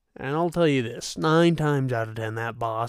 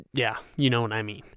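usual speaking voice

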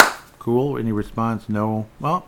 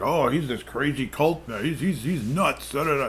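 higher-pitched mock dismissal; mocking critics; 5 semitones higher than usual. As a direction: imitate scoffing critics in a pitched-up voice. Mimics critics dismissing an actor.

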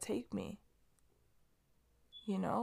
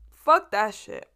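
emphatic dismissal; 6 dB louder, slower than usual. Real, not acted. Dismissing appearance worries.

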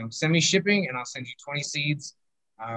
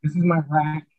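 audio cutting in and out; broken-up call audio, dropouts; corrupted glitchy audio; 2 semitones higher, 4 dB louder, faster than usual. Real, not acted. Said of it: "Your audio is cutting out a little bit".

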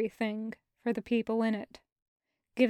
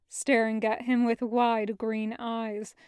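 plain reading voice

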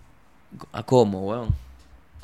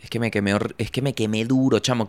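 ordinary delivery

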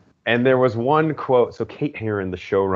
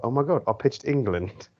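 put-on British accent; 4 semitones higher than usual. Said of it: "read it in a British accent".

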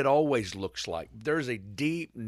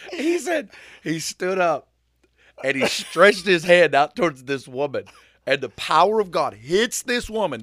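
louder, higher, thrilled storytelling; rising excitement; 6 semitones higher, 9 dB louder than usual. Real, not acted. Host telling the miracle to his cohost, thrilled and louder. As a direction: build it up, caught up in the miracle.

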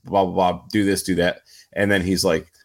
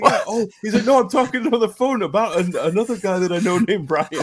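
quoting through laughter; 9 semitones higher than usual. Real, not acted.

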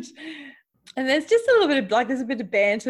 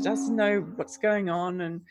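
ordinary talk

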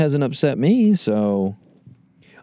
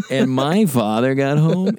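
ordinary delivery

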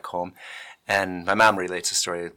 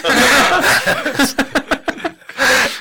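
high loud helpless laughing; loud laughter; 10 dB louder than usual. Real, not acted. Storyteller cracking up at own tale. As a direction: burst out laughing.

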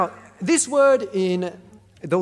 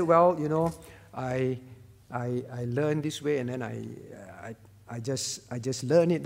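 low, quieter, halting mock reply; offhand, hesitant; 7 semitones lower, 4 dB quieter than usual. Preacher voices the word Paul didn't choose, offhand and hesitant. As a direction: trail into a soft, stumbling shrug of an answer. Voicing a casual, rejected answer.

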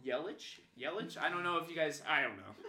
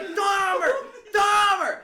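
high, loud holler; screaming heckle; 15 dB louder, slower than usual. Retold or imitated. Rival fan pointing and calling the nickname, screaming. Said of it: "he's screaming at me".

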